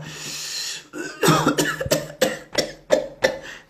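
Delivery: mimed choking fit; imitated choking cough. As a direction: hack and choke as if something went down the throat. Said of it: "No estoy tosiendo, te estoy diciendo lo que le pasó".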